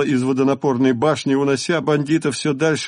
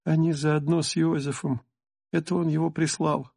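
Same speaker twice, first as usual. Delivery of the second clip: hushed, softer voice; whispering; 4 semitones higher, 6 dB quieter than usual. A narrator's reading. Kira whispering in hiding.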